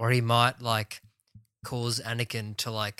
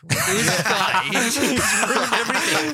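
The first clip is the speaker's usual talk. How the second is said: loud, high-pitched comic indignation through laughter; mock outrage; 12 semitones higher, 10 dB louder than usual. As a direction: shout it in laughing disbelief.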